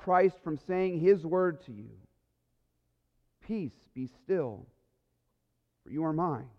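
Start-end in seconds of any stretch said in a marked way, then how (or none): 3.4-6.5 gentle. Acted. Christ's words voiced to listeners.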